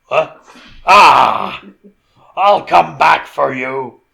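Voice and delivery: loud, hammed-up evil threat; big mock-menacing bellow; funny villain voice. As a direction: roar it out slowly like a cartoon villain. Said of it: "He's just doing a funny voice".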